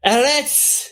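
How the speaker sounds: high-pitched incantation cry; shouted spell. Acted. Joan casts at the figure with her wand, shouting. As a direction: call out the spell, pitched well up. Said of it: "points out her wand at Figure and shouts".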